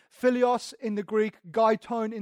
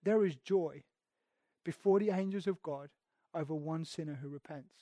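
hushed reverence; 5 semitones lower, 8 dB quieter than usual. Acted. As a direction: lower and much softer, fading out. Repeating verse softly.

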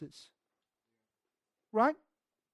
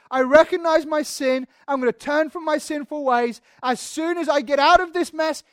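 louder, higher, emphatic promise; mock earnest vow; 4 semitones higher, 6 dB louder than usual. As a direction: proclaim it like a hearty vow. Voicing a vow Jonah never makes.

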